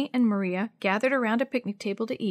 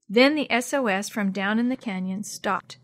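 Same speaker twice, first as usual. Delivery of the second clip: ordinary delivery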